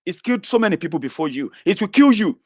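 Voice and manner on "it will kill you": ordinary talk, nothing marked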